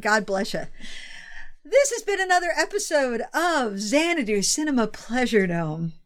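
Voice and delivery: usual speaking voice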